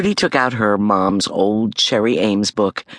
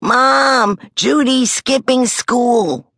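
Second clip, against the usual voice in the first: high, slow tattletale call; tattling yell; 10 semitones higher, 3 dB louder, much slower than usual. A narrator's reading. Brother yelling to Mom that Judy skips school. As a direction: drag it out to tell on her. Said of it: "Stink yelled".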